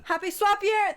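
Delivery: high-pitched, lively holiday greeting; excited cheer. Pretend. Wishing viewers a happy swap year, excited cheer.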